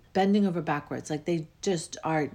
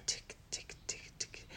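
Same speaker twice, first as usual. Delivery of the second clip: soft whispered ticks, much softer; clock ticking; 15 dB quieter than usual. Retold or imitated. Describing her Big Ben clock, a faint whispered ticking.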